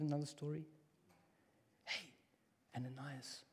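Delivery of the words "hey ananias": soft hushed whisper. Shown as a demonstration. Preacher whispers God calling Ananias again. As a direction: breathe the name like a quiet call. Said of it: "when I whisper it".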